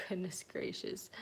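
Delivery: soft whispered exclamation; whispered aside. Real, not acted. Owner whispering an aside while laughing. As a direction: whisper it under the breath.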